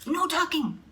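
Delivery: high, stubborn crew protest; mock-gruff pirate chant; yelled. A narrator's reading. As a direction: shout it back, flat refusal. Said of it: "the crew yelled".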